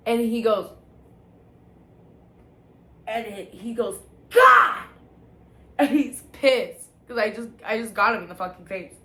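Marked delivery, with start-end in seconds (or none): 4.3-4.8 sudden loud, high shout; explosive one-word yell, acting him out; imitated angry yell. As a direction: burst out as someone just punched. Acting out dad's pained, angry yell.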